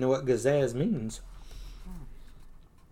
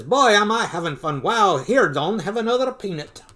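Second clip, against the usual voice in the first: lightly acted jolly host, louder; Uncle Scrooge, merry; 5 semitones higher, 5 dB louder than usual. Rich uncle at his party, mildly hammed-up cheer. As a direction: make merry with punchy exclamations.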